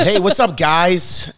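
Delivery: hammed-up influencer hello; mock a cheesy influencer intro; influencer greeting. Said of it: "you're an idiot".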